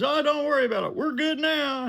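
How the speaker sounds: high, breezy rich man; easygoing boss reassuring the driver, high and loud; high client voice. Retold or imitated. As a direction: pitch way up and wave it off. John voiced in the retold story.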